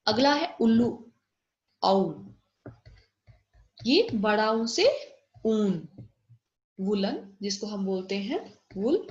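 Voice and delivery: normal talking voice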